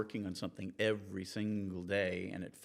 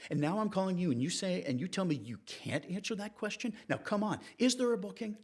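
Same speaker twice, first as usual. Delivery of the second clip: climbing from plain to much higher, exasperated by the end; building frustration; 5 semitones higher than usual. Retold or imitated. Reporter pressing the seaway official after a year of calls, rising exasperation. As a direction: start level, then let the exasperation push the pitch up. Reporter finally confronts Michelle.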